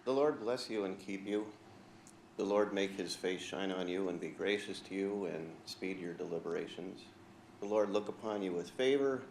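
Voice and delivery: soft, hushed benediction; prayer blessing. Acted. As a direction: bless the room gently, like a prayer.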